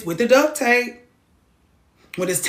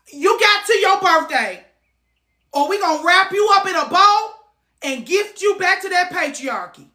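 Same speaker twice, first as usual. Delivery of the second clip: mock threat; 8 semitones higher than usual. High loud mock-threat lecture.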